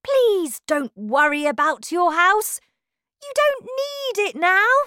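high, loud, animated dismissal; breezy reassurance. A narrator's reading. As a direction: brush the worry aside with gusto. Wonka brushes off the wrecked house.

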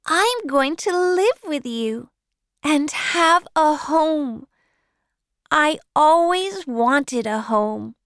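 put-on a girl who can't believe she gets a home; delighted little girl. Orphan girl tells Matthew her joy, high and excited.

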